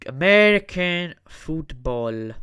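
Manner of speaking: slow, loud and drawn out; loud announcement. Acted. Host announcing the next sport, loud and slow. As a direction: announce the name like a ring announcer.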